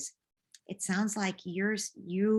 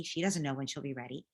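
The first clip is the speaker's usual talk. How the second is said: ordinary talk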